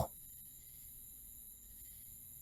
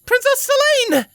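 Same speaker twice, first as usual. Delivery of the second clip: high-pitched urgent cry for the princess; young soldier voice, high and urgent; frantic soldier's call; 3 dB louder than usual. A narrator's reading. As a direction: call out to her, frantic and rushed. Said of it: "An equestrian soldier frantically called out".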